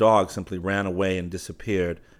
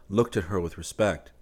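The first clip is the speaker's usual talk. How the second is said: ordinary delivery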